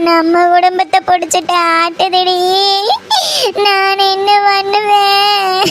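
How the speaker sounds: sustained wail breaking into sobs; keen over the family's curse, sobbing at phrase ends; crying lament. Acted. Mother blaming past sins for the family's misfortune, crying lament. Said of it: "அழுதுட்டே இருக்க".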